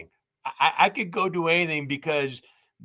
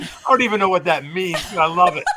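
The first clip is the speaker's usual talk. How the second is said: louder, laughing through the words; real laughing joy; laughing delight; 4 semitones higher, 7 dB louder than usual. Real, not acted. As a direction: burst out laughing as you say it. Reacts to being called effervescent.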